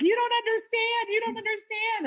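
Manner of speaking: high, loud pleading protest; mock desperate plea. Telling her coach he doesn't get it, high pleading protest. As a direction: whine it up high like a frustrated client.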